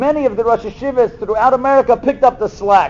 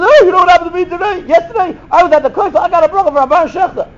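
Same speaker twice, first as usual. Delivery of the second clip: excited bragging; 8 semitones higher, faster than usual. Retold or imitated. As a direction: gush like a kid who can't contain it. Boy brags at lunch.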